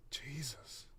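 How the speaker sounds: whispered under breath; shocked whisper. Acted. Kevin stares wide-eyed.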